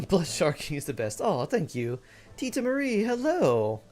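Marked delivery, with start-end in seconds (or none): 2.4-3.8 high bright cheerful hello; excited greeting. Real, not acted. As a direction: greet a friend with delight.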